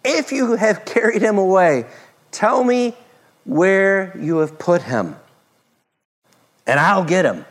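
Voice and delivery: pleading Mary. Acted. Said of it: "she said".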